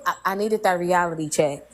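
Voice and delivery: usual speaking voice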